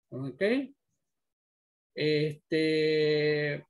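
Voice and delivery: ordinary talk, nothing marked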